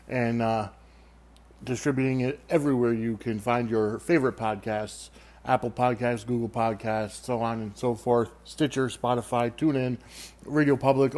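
usual speaking voice

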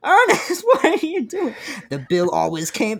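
loud, high laughing outcry; amused yell at a writer; laughing protest. Acted. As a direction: laugh while scolding the author.

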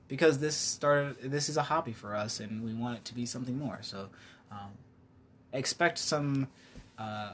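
ordinary talk

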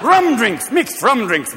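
usual speaking voice

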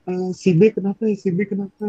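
acting out a panicked cry for help; retold alarmed call for help. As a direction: call for help as your friend collapses.

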